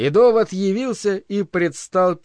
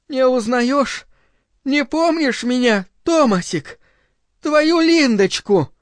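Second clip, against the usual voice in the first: high, gushing woman's voice; exclaiming Linda; 7 semitones higher than usual. A narrator's reading. Linda greeting her long-lost lover, exclaiming. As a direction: climb into high, pleading questions. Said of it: "воскликнула".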